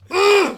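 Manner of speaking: loud, high mock groan of disgust; exasperated groan. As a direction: imitate a fed-up coworker's big "ugh". Imitating Andrew coming home fed up.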